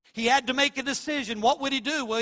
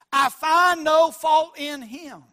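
slow, loud, drawn-out declaration; Pilate's verdict; 5 semitones higher, 10 dB louder, slower than usual. Retold or imitated. Pilate declares Jesus innocent, slow loud proclamation. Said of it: "Pilate says".

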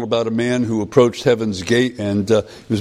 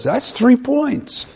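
much higher, slow angel with a laugh in it; 13 semitones higher, much slower than usual. Retold or imitated. Angel tells the man his score, high, slow and amused. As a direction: deliver the verdict slowly, amused.

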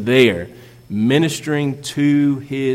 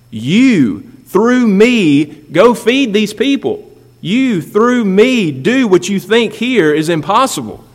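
higher, louder, slow insistent charge; emphatic urging; 7 semitones higher, 4 dB louder, slower than usual. Retold or imitated. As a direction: press each word on them. Jesus urges the disciples.

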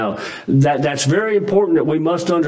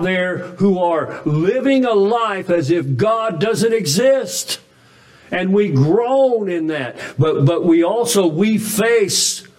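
higher, pressing sermon voice; fervent preaching; 7 semitones higher than usual. Acted. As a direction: plead with the congregation, heart on sleeve. Preaching at full force.

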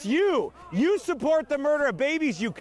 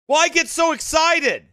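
raised-voice mimicry of a complainer; mock protest; 3 semitones higher, 7 dB louder than usual. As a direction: imitate a critic objecting, voice raised. Mimics critics of his preaching.

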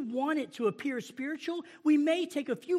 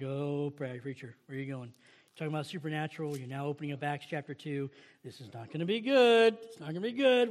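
nervous congregant; 8 semitones lower than usual. Retold or imitated. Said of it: "You're like".

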